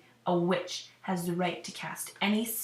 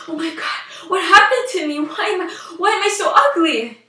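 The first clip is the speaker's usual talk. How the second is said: high, loud panic at the mirror; horrified outburst; 8 semitones higher, 9 dB louder than usual. Acted. Princess seeing her ugly reflection, loud horrified panic. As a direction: cry out in horror at your own face.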